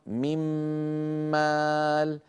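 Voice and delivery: "من مال" said as one long humming doubled m, no n; noon into meem. Shown as a demonstration. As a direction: hold the m between "من" and "مال". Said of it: "ومثاله عند النون".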